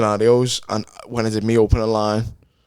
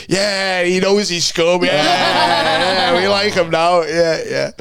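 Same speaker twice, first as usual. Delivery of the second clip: high, laughing crowd won over; a hint of jeering rugby lads; lightly voiced crowd; 7 semitones higher, much slower than usual. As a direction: cheer like a rough crowd warming to him. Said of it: "They all just went".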